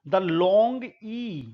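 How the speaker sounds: The long E drawn out very slowly; long vowel demo. Shown as a demonstration. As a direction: stretch out long E for the class. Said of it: "the long E जिसको बोला जाता है आई". (The long E को बहुत धीरे खींचकर बोलना)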